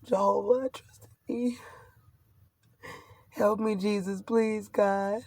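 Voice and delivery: hushed, pleading prayer under her breath; quiet prayer. Real, not acted. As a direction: softly beg God for help. Whispered prayer to God.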